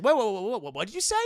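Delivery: acted alarm, loud burst of whoas; startled interruption. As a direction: cut in, taken aback. Acting out the startled character.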